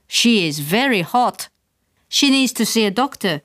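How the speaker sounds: lightly voiced Dutch nurse giving her verdict. A narrator's reading. Nurse tells mother, lightly Dutch-accented.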